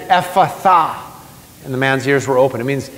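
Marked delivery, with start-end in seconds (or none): 0.1-1.0 firm, raised word of command. Retold or imitated. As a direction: pronounce it as a command, pitched up. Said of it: "he commanded".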